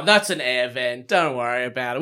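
low, soft, fake-calm brush-off; dismissive mock reassurance. Mocking Milo's dismissal.

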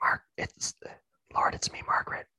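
whispered late night phone call; hushed, teasing whisper down the line; giggly late night call. Pretend.

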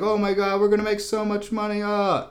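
high, quick, thrilled outburst; picture the cash rolling in; excited cheering. Retold or imitated. Sykes cheering as his horse leads, excited and high.

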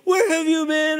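high, loud frantic call; worried shout. Retold or imitated. Mom running to her lost son, shouting high. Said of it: "She's like".

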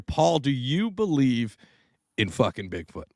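ordinary delivery

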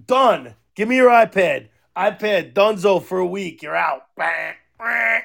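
ordinary delivery

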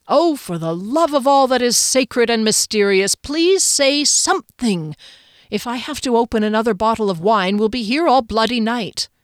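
emphatic, fed-up outburst; exasperated plea. Acted. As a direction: beg for a response, at wit's end. Exasperated plea to silent listener.

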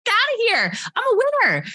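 high, defiant mock retort. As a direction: brush it off, cocky and sure. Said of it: "I was just like".

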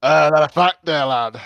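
put-on Scottish orc; Scottish accent. Disguised orc doubts Arnulf's excuse, in a Scottish accent. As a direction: question him in a Scottish accent. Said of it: "That's really Scottish".

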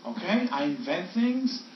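slow, mock-serious Boston accent; hammed-up Boston movie inventor, drawn out; Mark Wahlberg. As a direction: spell it out slowly in a put-on Boston accent. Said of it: "What accent is that?".

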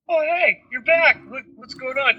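put-on high, bright-eyed child chirping; little girl voice. Father voicing his young daughter, high child voice. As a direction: play the toddler, cheerfully oblivious.

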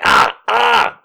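high, short cries of pain; acted-out pained "ah"; pained yelps. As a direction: yelp as each push hurts. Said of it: "it really hurts".

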